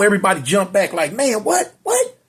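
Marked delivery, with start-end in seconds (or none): 1.1-2.2 shocked disbelief. Retold or imitated. Everyone jumps back, shocked.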